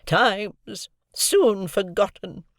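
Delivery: slow mournful moan. A narrator's reading. Havisham lamenting to Estella, slow moan. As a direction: drag the words out in grief. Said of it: "moaned Miss Havisham".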